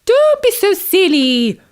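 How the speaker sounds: stern mother frog scolding. Acted. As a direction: scold the child firmly. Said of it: "said Tim's mum".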